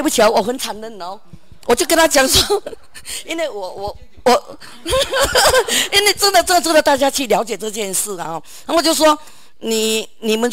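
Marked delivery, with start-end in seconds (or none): 4.6-5.9 high laugh. Real, not acted. Laughs mid-story.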